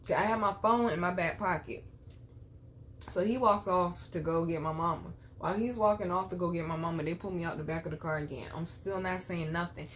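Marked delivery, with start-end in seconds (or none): none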